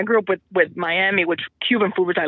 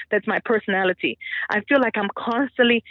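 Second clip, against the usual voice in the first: ordinary delivery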